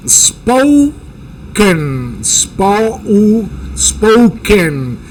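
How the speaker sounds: "spoken" said slowly, the O gliding into U; demo pronunciation. Shown as a demonstration. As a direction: stretch "spoken", start on O and end on U. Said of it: "Se comienza con O, termina con U". ("spoken" lento, de O a U)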